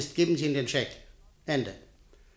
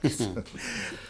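short quiet laugh; 7 semitones lower, 9 dB quieter than usual. Acted. Amused at the minister's eagerness.

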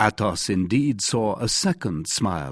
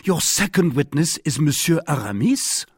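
high, incredulous question; astonished, voice lifted all through; great astonishment; 7 semitones higher, 3 dB louder than usual. A narrator's reading. D'Artagnan asks his opponent about the second witness, greatly astonished. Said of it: "in an accent of greater astonishment than before".

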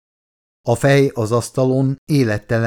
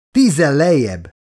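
higher, urgent order; urgent command; 4 semitones higher, 4 dB louder than usual. A narrator's reading. Doctor's voice shakes her colleague from helplessness, urgent command. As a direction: jolt the listener with a firm call. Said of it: "rázta fel Fordot tehetetlenségéből Shaw hangja".

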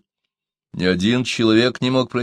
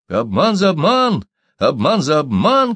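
high, loud, slow chant; repeated loud battle cry; crowd shouting; 7 semitones higher, 5 dB louder than usual. A narrator's reading. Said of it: "кричали гольды".

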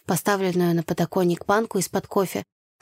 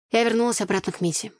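usual speaking voice

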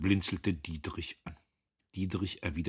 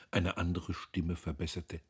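plain reading voice

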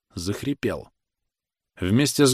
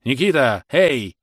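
raised, louder call for attention; 4 semitones higher, 4 dB louder, slower than usual. Acted. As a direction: hail a man in the crowd. Official calls into the crowd. (окликает, повысив голос)

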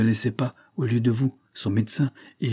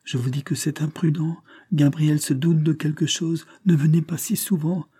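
higher-pitched, urgent warning; acted feverish urging, raised in pitch; delirious pleading; 6 semitones higher than usual. A narrator's reading. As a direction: plead with someone only you can see, pitched up. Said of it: "Elle s'adressait à quelqu'un qu'elle semblait voir".

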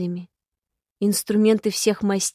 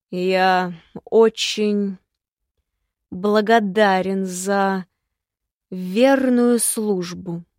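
slow, loud and bitterly mocking; bitter mockery; 5 dB louder, much slower than usual. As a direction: drag out the thanks with bitter scorn. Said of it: "звучала горькая насмешка".

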